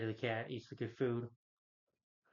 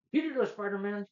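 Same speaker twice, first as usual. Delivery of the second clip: high mock surprise; surprised Ned; 9 semitones higher, 4 dB louder than usual. Ned butting into the talk about Spider-Man, high and surprised. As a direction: blurt it out amazed. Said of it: "And Ned says".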